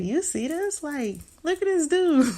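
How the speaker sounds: high, amused disbelief; amazed excitement. Retold or imitated. Parents marvel at son with the couple, high amazed. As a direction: recreate the wide-eyed "look at him" moment.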